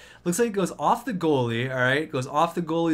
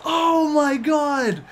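screaming much higher and louder, cheering; real ecstatic shout; 12 semitones higher, 7 dB louder than usual. Real, not acted. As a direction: yell the winner home like a fan. Overtime winning goal.

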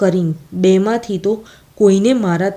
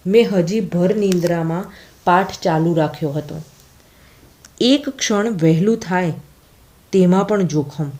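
ordinary delivery, nothing marked

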